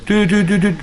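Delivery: high, even toots; short horn-like "tu" notes; imitated shofar blasts. Shown as a demonstration. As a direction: toot like a shofar. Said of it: "on va écouter le son".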